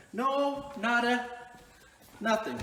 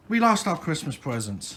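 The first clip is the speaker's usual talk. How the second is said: low, soft, defeated; dejected sigh; 9 semitones lower, 7 dB quieter than usual. Real, not acted. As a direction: admit it with a heavy sigh. Confessing the loss with a sigh.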